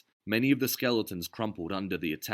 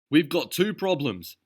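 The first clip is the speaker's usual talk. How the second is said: slow, higher, louder voice; urgent warning; 9 semitones higher, 7 dB louder than usual. A narrator's reading. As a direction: lay it out tensely. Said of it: "Derville said".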